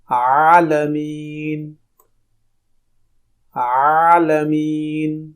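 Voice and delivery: عالمین stretched slowly with a throaty opening آ; slow teaching recitation of عالمین; slow drawn-out ain. Shown as a demonstration. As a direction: draw out عالمین, press the ع. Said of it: "یہاں پہ آواز نکلے گی آ".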